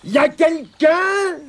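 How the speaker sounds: high, slow, drawn-out call. A narrator's reading. Tirondin calling under the bridge, shouting out. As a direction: call into the distance, stretching the words. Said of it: "cria bien fort".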